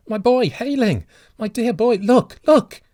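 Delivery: high, loud, breathless begging; frantic pleading. A narrator's reading. Father begging the stranger to look at his son, frantic. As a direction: point and plead wildly. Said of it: "said the old man wildly".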